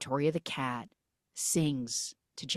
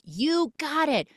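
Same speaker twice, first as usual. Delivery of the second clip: high, slow cheering-on; hammed-up pep talk squeal; mock encouragement; 11 semitones higher, 4 dB louder, slower than usual. Cats build up the scared magician, high mock encouragement.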